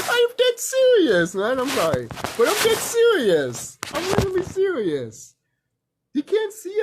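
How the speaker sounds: giggly "I'm serious" insistence; laughing through it. Real, not acted. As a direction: insist while cracking up. Still laughing after the joke.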